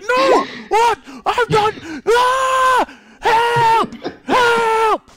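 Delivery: high, loud panicked shouts; screaming for help. Acted. Auctioneer dragged into the alley, screaming for help. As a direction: scream for help like you're being dragged away. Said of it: "And he's like".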